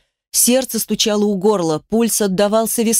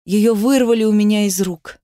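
usual speaking voice

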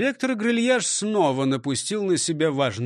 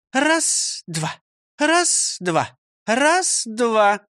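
counting drill; 7 semitones higher, much slower than usual. Acted. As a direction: drill him in rhythm. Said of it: "Продолжим урок". (считает ритм)

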